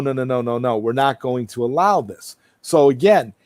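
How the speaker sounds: ordinary talk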